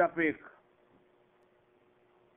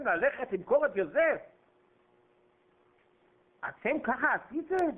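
high, pressing accusation; heated rebuke; 6 semitones higher than usual. Acted. Preacher accuses the brothers of bringing God into their court, pitched high. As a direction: press them hard on what they did.

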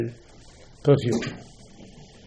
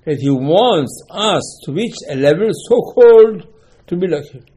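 ordinary talk

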